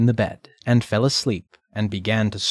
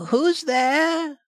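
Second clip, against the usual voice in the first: put-on old grandmother voice. Grandmother answers the knock.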